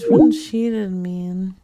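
ordinary talk

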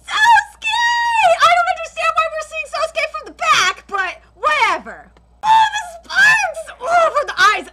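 high, loud yelling with laughter; excited scream. Real, not acted. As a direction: shout your hype at the screen. Fan screaming at trailer.

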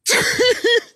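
loud, high-pitched cackle; high giggle. Real, not acted. Laughing at mom's boyfriend, high cackle.

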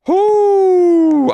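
long, high, loud "whoo"; sustained high hoot after a scare; high relieved whoop. Real, not acted. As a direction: let out a falsetto whoop. Scared by a chair in game.

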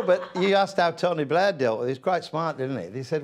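ordinary talk, nothing marked

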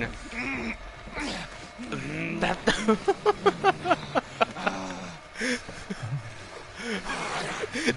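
long high giggling; giggling fit. Real, not acted. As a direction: breaks into giggles. Laughing at friend.

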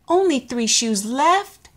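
usual speaking voice

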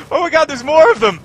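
very loud, high yell of alarm; real panicked shout. Real, not acted. More enemies appear.

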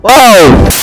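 loud, sudden pretend shock; startled shout. Georgie watches infected Penny, loud startled shout. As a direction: cry out as if startled.

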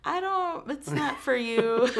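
high mock dismissive voice; dismissive casting people. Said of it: "and they're like".

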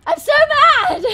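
high loud slow exasperated yell; real frustrated yell; frustrated shout. Real, not acted. Frustration after fight.

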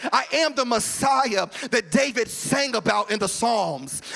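loud, driving preacher's cadence; full-force preaching. Acted. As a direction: preach it out to the whole room.

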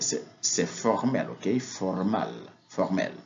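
usual speaking voice

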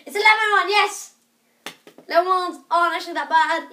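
high loud happy "lemon one"; excited. Real, not acted. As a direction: cheer at the flavour.

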